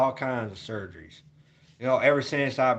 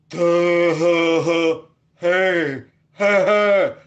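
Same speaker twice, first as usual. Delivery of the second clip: goofy dazed imitation of the roommate, laughing loudly; dumbed-down imitation; 2 semitones higher, 6 dB louder, much slower than usual. Vlogger mocking his medicated roommate, loud dopey laugh. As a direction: mimic a drugged-up friend, loud dopey laugh. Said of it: "when he takes his medicine".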